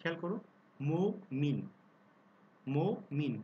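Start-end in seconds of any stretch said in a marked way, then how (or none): 0.8-1.6 মো মিন split into two beats; মমিন শব্দটি দুই অক্ষরে ভেঙে; syllable by syllable. Shown as a demonstration. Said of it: "যেমন আমি উচ্চারণ যখন করছি, খেয়াল করো". 2.6-3.3 মো মিন split into two beats; মমিন শব্দটি দুই অক্ষরে ভেঙে; syllable by syllable. Shown as a demonstration. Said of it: "যেমন আমি উচ্চারণ যখন করছি, খেয়াল করো".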